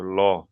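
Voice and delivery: ল said alone with a full, rounded O vowel; isolated ল with a heavy L; heavy lo sound. Shown as a demonstration. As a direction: give ল a dark, heavy sound. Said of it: "অর্থাৎ ল করে পড়তে হবে".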